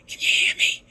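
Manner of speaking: unvoiced whispered mother on the phone. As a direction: whisper like mom hiding at work. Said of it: "Why are you whispering?".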